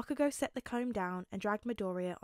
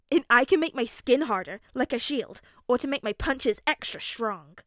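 put-on proud, louder boy's boast; boastful boy hero; 3 semitones higher, 10 dB louder than usual. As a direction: show off your power with punchy emphasis. Boy boasts of his power.

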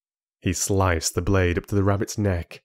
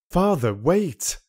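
urgent plea; 8 semitones higher, slower than usual. Acted. Son stopping father's blow.

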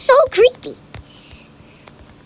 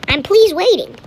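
louder, insistent repeat; impatient; 3 semitones higher, 7 dB louder than usual. Real, not acted. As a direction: push it out louder, losing patience.

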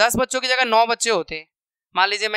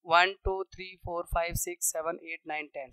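hushed, lower-pitched running count; counting to self; 4 semitones lower, 10 dB quieter than usual. Real, not acted. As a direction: tick off numbers under your breath. (दबी आवाज़ में गिनती)

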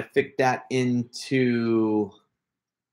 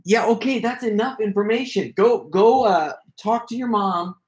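hurried shooing; 6 semitones higher than usual. Real, not acted. Dad shoos oversharing child off stream.

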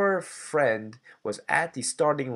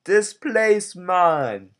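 bad Jamaican impression; 7 semitones higher, 10 dB louder, much slower than usual. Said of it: "a very bad impression of a Jamaican man".

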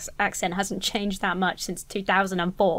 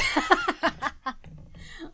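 high burst of laughter; 9 semitones higher, 4 dB quieter than usual. Real, not acted. Reacting to Essex setting.

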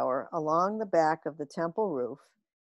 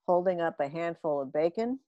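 ordinary delivery, nothing marked

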